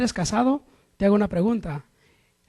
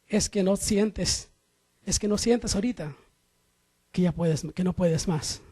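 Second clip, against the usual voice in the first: ordinary talk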